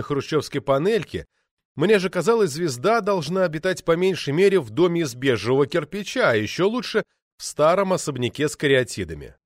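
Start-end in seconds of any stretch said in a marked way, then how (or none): none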